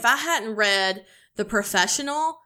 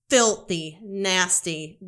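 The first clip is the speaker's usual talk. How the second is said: ordinary talk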